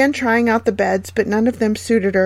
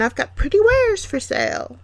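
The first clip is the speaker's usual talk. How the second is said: put-on very high cackling old crone voice; old peddler woman; 11 semitones higher than usual. A narrator's reading. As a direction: sell it like a wheedling old hag. Disguised queen as peddler.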